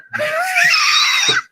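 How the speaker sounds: shrieking burst of laughter. Real, not acted. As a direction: let out a big high laugh.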